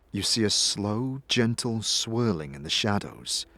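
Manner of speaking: normal talking voice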